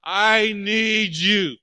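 loud, high, slow anguished plea; desperate cry to God. Retold or imitated. Man alone in his car crying out to God, loud anguished plea. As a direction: cry out to God, stretched and breaking. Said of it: "I looked at God and said".

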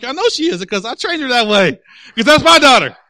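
high, loud retort building to a shout; retold heated comeback; proud defiant comeback. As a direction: fire back, ending on a shouted boast. Acts own comeback in quarrel.

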